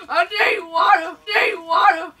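loud pleading for water; desperate plea. Real, not acted. Desperate after hot wing.

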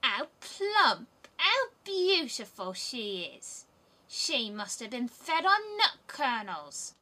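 high, loud, slow gloating hag; greedy old robber woman. Acted. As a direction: savour the plump child like a meal.

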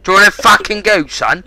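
fast, loud taunt; aggressive challenge. Real, not acted. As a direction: square up and spit it out quickly. Picking fight over chat.

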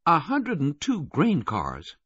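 high, slow, measured woman's voice; Kathy counting. A narrator's reading. Kathy counting freight cars as they pass, high and slow. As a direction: draw it out in a high voice as if tallying.

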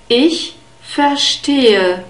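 slow, clear "ich verstehe" with a long e; careful German. Shown as a demonstration. Teacher modelling the phrase slowly for learners. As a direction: spell out each sound of "ich verstehe" slowly. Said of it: "an unstressed E".

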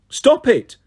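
high, loud stop it; yelled. Acted. Percy yells at the harpies attacking Ella, loud and high. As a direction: yell to drive the harpies off. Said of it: "Percy yelled".

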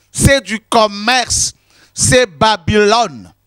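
slow emphatic. Acted. Preacher denouncing church commerce.

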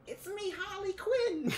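high, slow Brooklyn Harley voice; Harley Quinn voice. Pretend. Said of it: "I like this voice".

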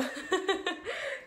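高めの大きな笑い; 吹き出して笑う; 笑い声. Real, not acted. 自分の言葉に吹き出す. (高く弾けた笑い)

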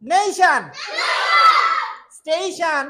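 ringing loud classroom call; loud drill. Shown as a demonstration. Teacher leading class in loud repetition, calling words. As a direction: call each word out loud for the class to echo. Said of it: "Speak loudly with me।".

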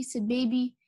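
ordinary delivery

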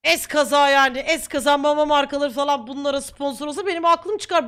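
ordinary talk, nothing marked

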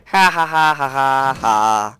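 hammed-up villain cackle, fading out; mock evil laugh. Gamer gloating over the spiders, mock evil laugh. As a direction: laugh like a cartoon baddie.

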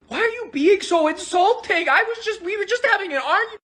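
high-pitched, hurt protest; upset protest. Acted. Hurt protest in acted quarrel.

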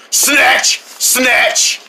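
usual speaking voice

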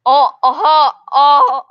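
ordinary talk

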